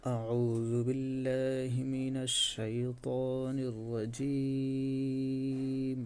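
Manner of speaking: أعوذ بالله من الشيطان الرجيم drawn out in slow melodic tajweed; slow Quran recitation. Shown as a demonstration. Teacher reciting for students to repeat, slow tajweed. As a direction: recite it slowly, each Arabic sound held long. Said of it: "আমার সাথে পড়ুন।".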